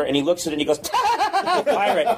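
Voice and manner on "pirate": high giggle breaking into the word; laughing. Acted. Professor sees the skeleton in pirate costume, laughing. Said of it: "'cause pirates make him laugh".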